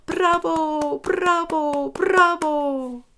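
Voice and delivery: high, loud bravo cheers; cheering dwarfs. Acted. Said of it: "alle Zwerge gejubelt".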